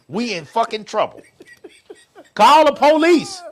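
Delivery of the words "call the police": loud call for the police. Acted. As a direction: belt it out, half laughing. Repeated joke about calling police.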